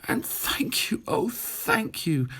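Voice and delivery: a high, whispered thanks; the rescued witch thanks her friends; grateful witch. Acted. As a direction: breathe out your thanks, overwhelmed. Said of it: "the grateful witch cried".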